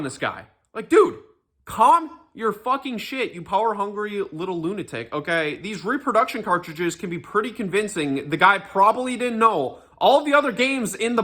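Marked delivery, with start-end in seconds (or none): none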